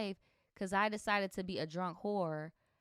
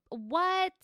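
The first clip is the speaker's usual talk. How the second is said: a high, loud shriek of disbelief; shocked what; 11 semitones higher, 7 dB louder than usual. Real, not acted. Reacting to her hooking up with the ex, shrieking in shock. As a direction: squeal it, much higher and louder.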